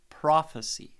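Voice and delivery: "prophecy" with PRO punched loud; stress on the first syllable. Shown as a demonstration. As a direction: hit PRO in "prophecy", let the rest fall away. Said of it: "Definitely stress on the first syllable".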